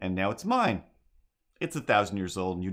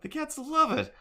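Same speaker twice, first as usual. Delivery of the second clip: way up high, squeaking it; high-pitched echo; high squeaky cat voice. Shown as a demonstration. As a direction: chirp it like a delighted cat. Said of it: "uh, the cats love it".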